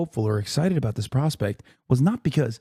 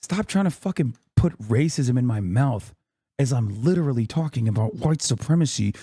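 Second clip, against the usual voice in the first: normal talking voice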